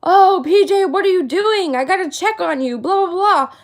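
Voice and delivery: sarcastic imitation of worried friends; mocking fake concern. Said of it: "turned everyone into, like, this".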